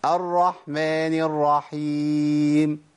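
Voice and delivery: slow chant with slack h in "الرحمن الرحيم"; lazy h in a drawn-out "الرحيم"; ha left unsounded. Shown as a demonstration. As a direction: recite "الرحمن" without bringing out the ح. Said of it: "أنا ما طلعتش الحاء".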